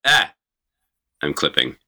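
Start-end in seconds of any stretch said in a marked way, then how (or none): 0.0-0.3 sudden short yelp of fright; startled scream. Real, not acted. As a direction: jump at the spider with a cry. Spider suddenly on him.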